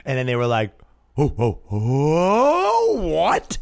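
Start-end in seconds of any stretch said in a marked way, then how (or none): 1.2-3.6 drawn-out, rising scandalized "oh"; imitating an outraged crowd; mock shock. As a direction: stretch the gasp out slowly, like a shocked crowd. Crowd reacting in shock to a remark.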